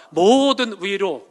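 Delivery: 모든 위로 shouted, very slow and drawn out; stress on 모든 위로. Shown as a demonstration. Preacher proclaiming 모든 위로 to the church, loud and very slow. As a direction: hammer 모든 위로 word by word. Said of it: "이건 위로해주고 이건 위로해주지 않고".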